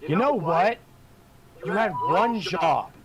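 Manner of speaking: mock scolding. Real, not acted. Player scolding dice after bad roll.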